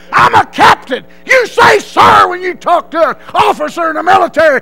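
high, full-force bellowing tirade; outraged captain. Retold or imitated. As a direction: roar at the recruit in disbelief. Outraged captain berates recruit.

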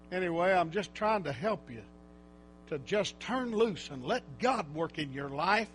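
ordinary talk, nothing marked

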